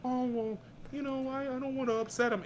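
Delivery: high-pitched, hesitant hedging; reluctant friend. Retold or imitated. Mocking the friend who won't be honest, reluctant high voice. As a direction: imitate a friend dodging the truth. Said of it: "not that friend that goes".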